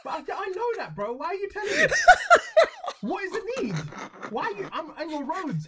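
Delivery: very high, indignant comic rant; mock outrage. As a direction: squeal back at a sign that insults you. Mock rant at sarcastic road signs.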